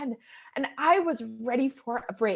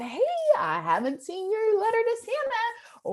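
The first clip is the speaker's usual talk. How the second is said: playful, much higher teasing question to her son; joking mom voice; 9 semitones higher than usual. Retold or imitated. Mother jokingly asking her son about his Santa letter, playful and higher. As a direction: lightly tease him about Santa. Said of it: "but I jokingly said".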